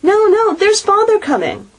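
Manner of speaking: put-on excited children's cry. A narrator's reading. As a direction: warn everyone father is here. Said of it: "cried the two young Cratchits".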